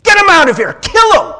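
high, loud furious mob yell; angry mob. Retold or imitated. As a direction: scream for his death. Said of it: "Later, they're like".

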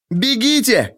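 high urgent shout. A narrator's reading. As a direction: yell at him to run. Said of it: "крикнула та".

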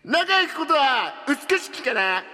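high, slow, theatrical declaration; grand proclamation. Acted. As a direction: proclaim it like a hero.

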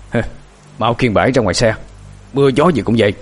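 gruff, furious master's command; irritated, forceful command; angry order. A narrator's reading. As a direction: order the servants with restrained rage. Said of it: "đã nổi điên lên tới đỉnh điểm".

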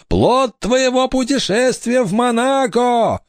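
high, slow wailing accusation; ghostly pronouncement. A narrator's reading. Ghost girl accuses pirate of fathering brother, high wail. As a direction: intone it with the last word drawn out.

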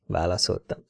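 ordinary delivery

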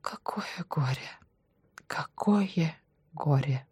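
broken, tearful whisper. A narrator's reading. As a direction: whisper it in helpless grief. Директор без сил падает на стул. (горестный шёпот)

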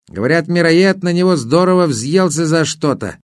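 a hint of a chatty river spirit; water spirit voice. Water spirit warns the hero.

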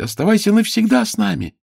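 high, loud pleading call; excited shout. A narrator's reading. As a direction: beg her to stay, shouting. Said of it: "закричали дети".

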